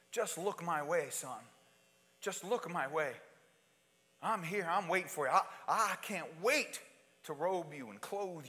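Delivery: soft, gentle calling of a waiting father; tender fatherly plea. Acted. God calls the prodigal son home, soft and tender. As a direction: coax the lost son home quietly.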